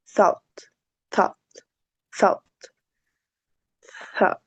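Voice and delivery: "thought" with tongue between teeth, repeated and fading; th sound drill. Shown as a demonstration. Teacher drills "thought" for Spanish speakers, tongue between teeth. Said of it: "la lengua se va a poner entre nuestros dientes".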